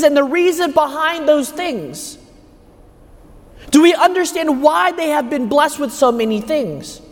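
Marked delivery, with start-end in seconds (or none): none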